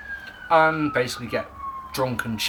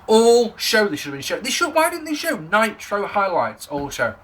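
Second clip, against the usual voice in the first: normal talking voice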